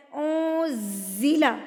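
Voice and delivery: اونزیلا slow with a strong ز; firm ز. Shown as a demonstration. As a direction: press the ز of اونزیلا hard. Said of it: "میری آواز میں تھوڑی سی شدت". (اونزیلا میں ز کو زور سے پڑھیں)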